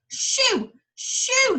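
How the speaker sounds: high, loud shooing; shouted shoo. Acted. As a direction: chase the bull off with a shout. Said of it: "he shouted".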